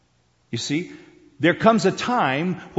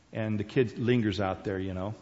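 normal talking voice

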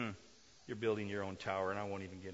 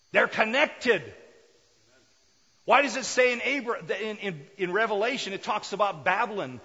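loud, fervent sermon delivery; preaching at full force; 10 semitones higher, 13 dB louder than usual. Acted. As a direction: preach it out with fire.

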